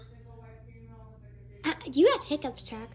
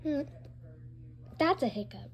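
ordinary delivery

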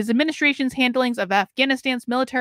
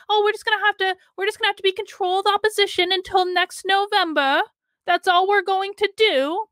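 mocking whine; 7 semitones higher than usual. Mocking Republicans' excuse.

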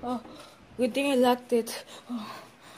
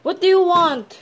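high, loud shout; alarmed demand; 5 semitones higher, 8 dB louder than usual. Acted. Confronting the clown, alarmed shout. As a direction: demand it of an intruder.

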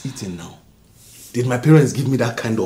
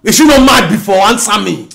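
much higher, loud demand; furious shout; 9 semitones higher, 11 dB louder than usual. Acted. Husband to wife about his mother, furious shout. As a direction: bark it at her.

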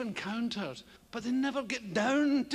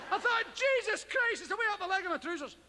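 higher, rushed alarm; retold panic; 6 semitones higher, faster than usual. Spider up his trouser leg, mock panicked cry.

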